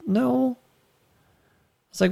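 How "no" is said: put-on higher, softer child's no; a shy small-girl answer; little girl's no. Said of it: "She was like".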